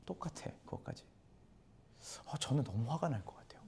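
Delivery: 숨소리로만 털어놓는 속마음; 비밀 고백하듯 속삭여; 속삭임. Real, not acted. 불공평한 품삯에 대한 속마음. (억울함을 속삭이듯 털어놓는 목소리)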